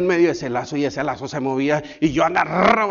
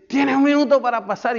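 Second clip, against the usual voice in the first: much higher, louder drill command; shouted order; 11 semitones higher, 6 dB louder than usual. Retold or imitated. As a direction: bark the time limit at the recruit. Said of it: "y me dicen".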